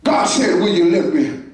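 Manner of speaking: ordinary delivery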